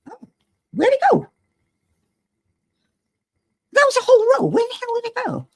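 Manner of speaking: high, puzzled where'd-it-go; baffled search. Real, not acted. Hunting her missing row of drills, high baffled voice. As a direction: pitch it way up in disbelief.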